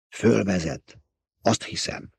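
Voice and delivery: hushed, half-whispered guess; whispering. A narrator's reading. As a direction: drop to a near whisper. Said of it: "suttogta Paul".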